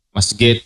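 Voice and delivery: masjid with a hard G, said "masget"; Egyptian way. Retold or imitated. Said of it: "Orang Mesir itu kalau ngomong".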